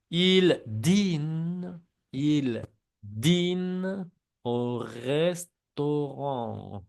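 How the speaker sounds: dîne au restaurant drawn out very slowly; slow model sentence. Shown as a demonstration. Teacher slowly models the sentence for the class. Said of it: "repiten las frases también".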